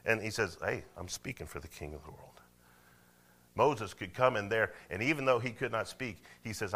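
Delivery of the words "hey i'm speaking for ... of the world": low, soft, matter-of-fact reply; quiet assertion. Acted. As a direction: drop right down after the shouting. Moses calmly answering shouting Pharaoh.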